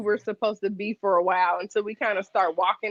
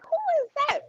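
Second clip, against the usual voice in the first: surprised high voice. Retold or imitated. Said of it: "I was like".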